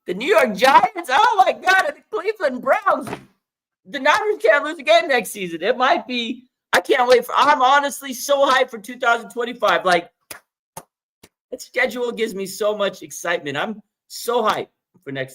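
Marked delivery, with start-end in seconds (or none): 0.1-3.1 excited squeal. Real, not acted. 3.8-13.6 hyped. Real, not acted.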